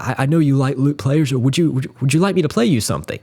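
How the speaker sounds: put-on young [name]. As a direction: imitate a keen young musician greeting his idol. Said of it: "of a young [name] going up to the".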